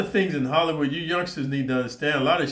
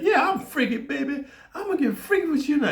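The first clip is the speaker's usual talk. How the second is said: mock girlfriend voice.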